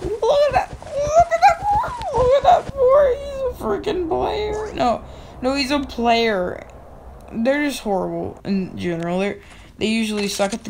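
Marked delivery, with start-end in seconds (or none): 0.0-4.7 very high, stretched squealing voice; mocking squeal. Gamer squealing in mock outrage at his noob teammate.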